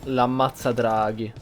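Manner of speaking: usual speaking voice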